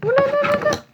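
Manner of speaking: high, loud squeal of delight; way up high and loud, thrilled; excited oulala. Real, not acted. Reacting to opening the parcel.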